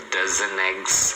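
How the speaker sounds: DOZEN EGGS as one word, "dozeneggs"; slow demo sliding the N of "dozen" onto "eggs"; linked words. Shown as a demonstration. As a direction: draw out "dozen eggs" without a break. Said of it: "você emenda também".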